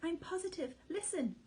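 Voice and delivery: high, insistent alarm; father fox urging his wife to listen; urgent. A narrator's reading. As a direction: press her to listen.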